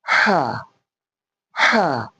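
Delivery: "ha" as a breath pushed out from the throat; breathy ha. Shown as a demonstration. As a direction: breathe out through "ha". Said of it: "We are going to breathe out".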